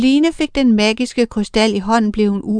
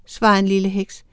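usual speaking voice

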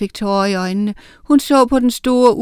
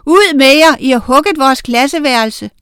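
high, loud, indignant yell; furious outburst, starting at full blast; angry shout; 5 semitones higher, 7 dB louder than usual. A narrator's reading. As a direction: shout them out of the room.

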